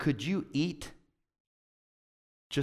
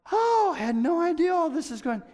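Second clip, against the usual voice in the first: high-pitched overwhelmed exclamation; imitated astonished newcomer; mock shocked elder; 12 semitones higher than usual. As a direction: blurt it out in hammed-up dismay.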